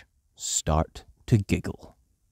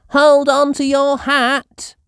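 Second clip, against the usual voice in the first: put-on high, loud and slow little girl; Peppa's voice; 8 dB louder than usual. A narrator's reading. Peppa warning George on a windy day, high and loud. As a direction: call out to your brother. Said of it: "says Peppa".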